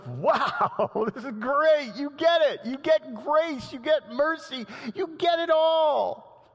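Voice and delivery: overjoyed, can't stop smiling; beaming Jesus. Retold or imitated. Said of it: "Jesus beaming with a big smile".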